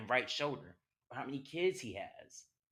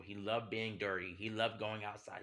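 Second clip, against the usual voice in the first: ordinary talk, nothing marked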